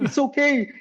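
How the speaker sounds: high-pitched mock comforting, holding back a smile; fake-sympathetic consoling. Roommate consoles the guy he just pranked, holding a smile back. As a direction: imitate a friend consoling while suppressing a laugh. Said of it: "trying to hold a smile back".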